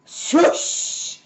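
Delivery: whooshing slide noise; swoosh. A narrator's reading. Narrator making the sound of the cub sliding downhill. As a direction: hiss out the slide.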